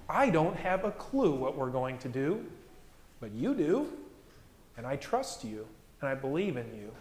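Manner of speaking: low, soft, trusting prayer; hushed surrender. Acted. Believer turning to Jesus in trust, hushed surrender.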